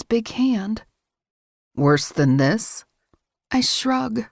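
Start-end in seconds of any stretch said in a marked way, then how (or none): 1.7-2.8 deep male voice. A narrator's reading. Male character voiced by narrator.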